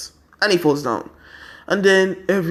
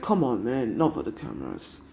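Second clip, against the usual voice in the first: hushed tease; 5 semitones lower, 9 dB quieter than usual. Retold or imitated. Teasing a teary rival.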